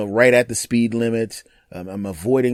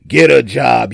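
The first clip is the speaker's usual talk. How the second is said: slow, much louder sneering yell; jerk yelling; 9 dB louder, slower than usual. Pretend. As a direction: bark it like a rude jerk. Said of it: "if I was an a-hole".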